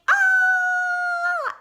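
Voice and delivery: long, high, loud howl of pain; wolf's scream. Acted. Wolf bopped on the head, long loud scream. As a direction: yell it wide open and hold.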